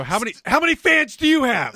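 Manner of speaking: high, loud mocking nosy-guy voice; high loud prying imitation of the poker guys. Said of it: "So when you go".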